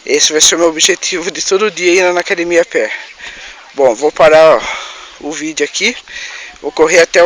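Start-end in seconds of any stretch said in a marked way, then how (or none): none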